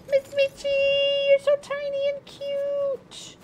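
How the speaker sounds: tiny, very high squeaky coo. Real, not acted. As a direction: coo over the cat in a high, soft little voice. Cooing over the cat.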